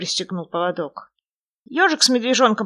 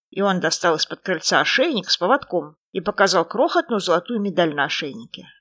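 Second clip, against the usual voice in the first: ordinary delivery, nothing marked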